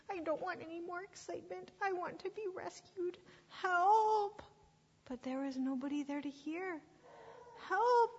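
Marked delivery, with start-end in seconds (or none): 0.1-3.2 high, plaintive little sheep; acted pitiful wail; miserable cry. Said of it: "he cried". 3.5-4.4 high, loud desperate shout; stranded sheep's drawn-out holler; cry for help. Acted. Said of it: "Blister cried again". 7.7-8.2 high, loud desperate shout; stranded sheep's drawn-out holler; cry for help. Acted. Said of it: "Blister cried again".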